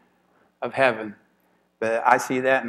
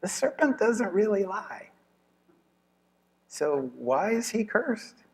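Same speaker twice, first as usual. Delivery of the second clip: puzzled Bible reader; 11 semitones higher, slower than usual. Retold or imitated. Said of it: "you're like".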